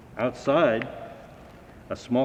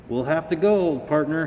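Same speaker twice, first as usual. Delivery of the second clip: calling out; 5 semitones higher, 4 dB louder than usual. A narrator's reading. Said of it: "he called".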